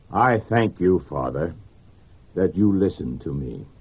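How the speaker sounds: a low, gentle voice of Jesus at prayer; Jesus praying. Acted. Jesus prays to the Father.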